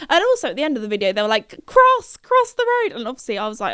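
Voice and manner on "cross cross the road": high, loud yell to a friend; imitated high-pitched urging; urgent calling out. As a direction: shout a hurried instruction across the road.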